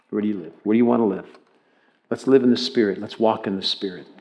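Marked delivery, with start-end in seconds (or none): none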